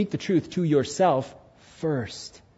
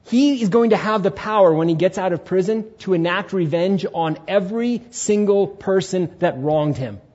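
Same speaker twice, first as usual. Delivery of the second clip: ordinary delivery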